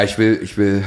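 usual speaking voice

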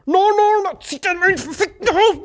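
high, loud mock "no, no"; squeaky imitation of the grabbing man; mock attendant. Retold attendant grabbing him. (hohe, laute Nachahmung)

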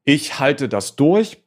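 slow, stretched and determined; slow defiant promise; drawn-out vow. Retold or imitated. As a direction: drag out each word like a vow.

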